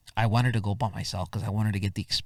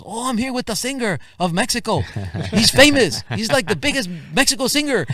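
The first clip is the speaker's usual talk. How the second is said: much higher and louder, star-struck; excited bragging; 12 semitones higher, 7 dB louder than usual. Retold or imitated. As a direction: brag to a friend about the celebrity. Driver brags on the phone.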